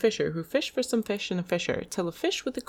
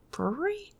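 puzzled; 9 semitones higher, 3 dB quieter than usual. Shown as a demonstration. Questioning repeat of word.

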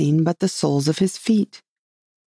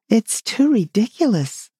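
exasperated cry; 7 semitones higher than usual. A narrator's reading. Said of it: "cried Alice".

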